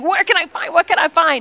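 high, loud, flustered muttering to self; mock frantic searching. Guest dumps out purse hunting a tip, frantic high mock panic. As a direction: play up a scramble for cash.

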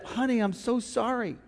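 tender apology. Retold or imitated. Pastor comforting his wife over the tea, softly. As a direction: soothe her gently. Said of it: "I said".

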